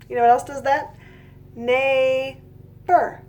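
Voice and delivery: ordinary talk, nothing marked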